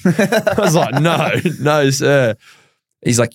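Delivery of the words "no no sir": mock refusal. Disbelief at being called.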